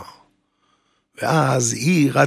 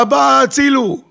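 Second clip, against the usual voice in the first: high-pitched acted cry for help; imitating a woman screaming for help; retold scream; 11 semitones higher, 4 dB louder than usual. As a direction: cry out to dad for rescue. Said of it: "היא צעקה".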